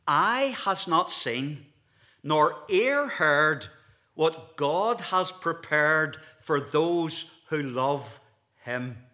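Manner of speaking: loud, very slow, weighty Bible recitation; scripture declamation. Real, not acted. Preacher quoting Paul to the congregation, loud slow declamation. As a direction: proclaim the verse slowly, stretching each phrase.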